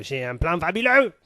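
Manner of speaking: high, loud put-on German accent in French; hammed-up Hitler German accent. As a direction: boast of the plan in a thick German accent. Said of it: "faire l'accent allemand".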